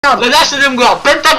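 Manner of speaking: loud, high pentakill call; excited esports caster. Real, not acted. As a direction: yell the kill out in excitement.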